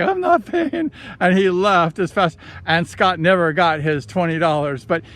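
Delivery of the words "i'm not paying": high, cheeky refusal while running off; mock bettor. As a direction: imitate the bettor weaseling out. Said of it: "He was like".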